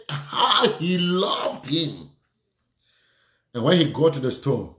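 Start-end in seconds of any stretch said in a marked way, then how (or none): 0.1-2.1 very slow, each word drawn out; preacher's drawn-out exclamation; marvelling scripture quote. Retold or imitated. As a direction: linger on every word as a preacher quoting.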